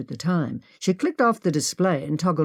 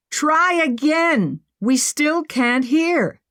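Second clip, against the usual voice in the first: shouting up; 10 semitones higher, slower than usual. Acted. Shouting through the ceiling.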